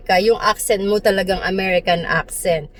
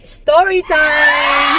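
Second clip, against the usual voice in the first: high, drawn-out "story time" call; big stretched-out storytime shout; playful announcement; 6 semitones higher, 3 dB louder, much slower than usual. Real, not acted. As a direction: sing out the announcement, long and high.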